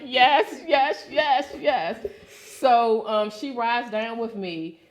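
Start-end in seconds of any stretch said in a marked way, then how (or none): 0.0-2.0 quick, eager, higher and louder yeses. Retold or imitated. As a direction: jump at the offer, half laughing. Eager yes to a prayer offer.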